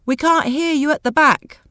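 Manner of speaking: high, loud call across the room; shouting from the back. Acted. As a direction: make them hear you at the back.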